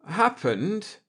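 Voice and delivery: high, rising stalling echo; buy time with a nervous echo. Acted. Akers stalling the navigator, high nervous echo. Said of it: "fought the panic in his voice".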